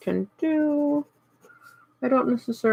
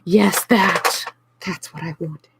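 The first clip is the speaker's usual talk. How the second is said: excited; 4 dB louder, slower than usual. Retold or imitated. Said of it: "and I was like".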